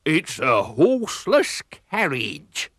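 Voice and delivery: slow, booming pirate bellow; thundering pirate captain. A narrator's reading. Pirate captain gapes at the car, slow booming thunder. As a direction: boom it out like an astonished pirate. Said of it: "he thundered".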